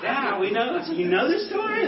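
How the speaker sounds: laughing, rising in mock amazement; teacher to boys, laughing in mock disbelief at their knowing; amused disbelief. Teacher to boys, a laughing high surprised question.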